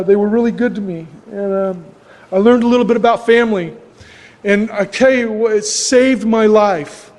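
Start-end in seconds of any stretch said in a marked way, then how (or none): none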